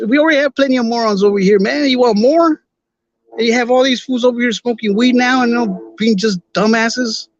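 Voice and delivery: exasperated rant. Real, not acted.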